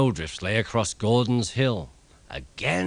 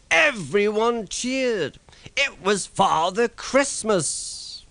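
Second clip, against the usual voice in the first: much higher, slow excited narration; excited reveal; 11 semitones higher, slower than usual. A narrator's reading. Narrator revealing Father Christmas arriving, slow high excitement. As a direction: announce the surprise with wonder.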